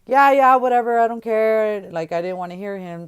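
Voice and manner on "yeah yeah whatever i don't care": loud, high yeah yeah whatever; dismissive brush-off. Retold or imitated. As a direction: wave him off defiantly. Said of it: "I was like".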